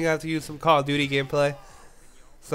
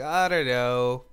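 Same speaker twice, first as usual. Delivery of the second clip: hesitant; 6 semitones lower, 4 dB quieter, slower than usual. Retold or imitated. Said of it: "like, hesitant".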